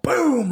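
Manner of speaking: a sharp, loud high "Boom!" like a punch landing; punch sound effect. Retold or imitated. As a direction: smack out the impact noise. Retelling slamming kid into locker.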